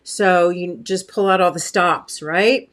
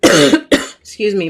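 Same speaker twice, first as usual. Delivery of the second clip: coughing apology; 5 dB louder, much slower than usual. Real, not acted. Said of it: "I'm getting a little choked up".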